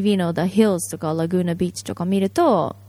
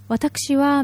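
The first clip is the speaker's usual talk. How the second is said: Dewi Fujin voice; 6 semitones higher than usual. Retold or imitated. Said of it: "デヴィ夫人みたいな話し方と".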